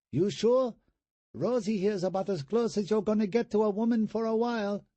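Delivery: put-on high falsetto, Vietnamese-accented come-on; falsetto hooker voice. A narrator's reading. Brother teasing brother with a dummy arm, falsetto hooker voice. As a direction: tease him in a squeaky hooker voice. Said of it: "still in his hooker voice".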